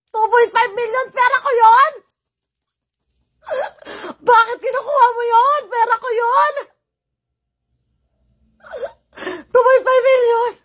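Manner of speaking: high, loud, crying rage; yelling in tearful fury; furious shout. Real, not acted. Furious outburst at sister over money.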